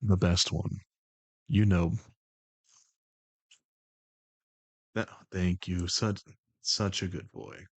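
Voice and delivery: low, measured, even put-on voice; The Voice, composed and commanding. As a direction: keep it low and level, in unhurried control. Holds The Voice.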